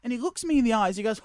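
ordinary talk

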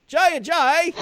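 high, British-accented "Jay Ajayi"; showing off the British name. Shown as a demonstration. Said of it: "I could do that all night".

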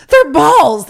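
loud high blurt, laughing; dad's blunt answer. Retold or imitated. Dad answering his kid about scrotum, a loud laughing blurt. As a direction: snap it out like an embarrassed dad. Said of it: "and he goes".

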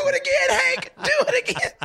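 squealing, very high falsetto; hammed-up shrieky egging-on; delighted party guest. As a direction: beg for the party trick in mock excitement. Playing delighted party guest begging.

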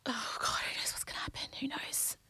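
whispered, teary oh God; near tears. Real, not acted. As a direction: voice about to crack. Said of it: "I don't wanna cry".

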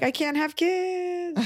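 very high, slow, drawn-out call; mock parting wail. To mother-in-law while driving off, high mock wail. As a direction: stretch it out as a teasing parting line.

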